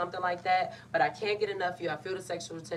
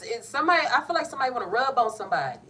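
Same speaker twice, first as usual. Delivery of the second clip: flustered excitement; 6 semitones higher, 6 dB louder, faster than usual. Real, not acted. Giggly flustered reading.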